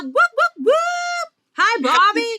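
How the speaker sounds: loud, excited intro rising into high whoops; big celebratory hype-up; hyped guest welcome. Real, not acted.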